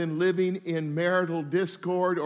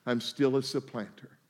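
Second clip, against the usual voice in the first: meek confession; 9 semitones lower, 7 dB quieter than usual. Retold or imitated. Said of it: "He was saying".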